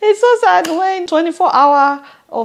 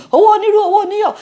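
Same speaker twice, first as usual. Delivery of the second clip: rapid proverb; 11 semitones higher, 8 dB louder, much faster than usual. Pretend. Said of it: "I will say".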